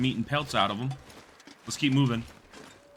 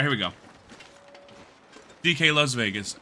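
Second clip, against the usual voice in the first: ordinary delivery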